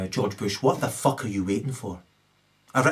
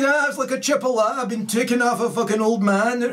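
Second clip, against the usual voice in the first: higher, louder Scottish comedian voice with cackles; Billy Connolly impression; 12 semitones higher, 4 dB louder than usual. Retelling a comedy bit in a loud Scottish voice. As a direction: tell it like a cheeky Glaswegian storyteller. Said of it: "he also did a good Billy Connolly impression".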